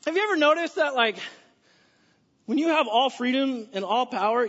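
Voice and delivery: ordinary talk, nothing marked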